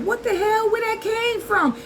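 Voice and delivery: high, startled what-the-hell; shocked disbelief. Retold or imitated. Retelling being called God, she squeaks in shocked disbelief. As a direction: recoil at an insult. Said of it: "I was like".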